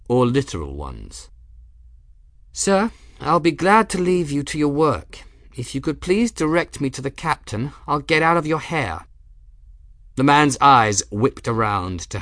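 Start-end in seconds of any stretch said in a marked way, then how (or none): 2.5-9.1 put-on higher, overly polite young voice; strained politeness; polite young woman. A narrator's reading. Gwen politely dismisses herself.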